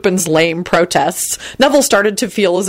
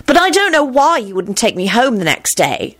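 stern scolding at raised pitch; 6 semitones higher than usual. A narrator's reading. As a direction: take him to task sternly. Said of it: "she added sternly".

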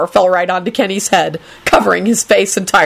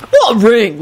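wary, slightly raised question; suspicious; 4 semitones higher, 3 dB louder than usual. A narrator's reading. Lucretia questioning Rosie about a ring, suspiciously. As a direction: ask it with suspicion. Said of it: "Lucretia said suspiciously".

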